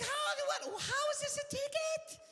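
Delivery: very high, slow squeaky disbelief; incredulous squeak. Retold or imitated. Comic getting a ticket, laughing, very high incredulous squeak. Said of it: "I'm like".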